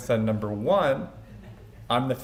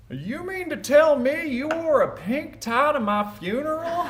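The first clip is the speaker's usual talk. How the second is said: grandpa's mock-indignant voice, pitched way up; Big Daddy impression. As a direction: imitate grandpa scolding, high and incredulous. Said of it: "in his voice right now".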